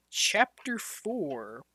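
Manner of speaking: slow whispered chapter title; slow dramatic title. A narrator's reading. Reading the chapter heading, slow and whispered.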